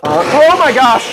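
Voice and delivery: high startled outcry, drawn out; a real jolt of surprise at the noise; oh my gosh, blurted in shock. Real, not acted. Startled by the blender.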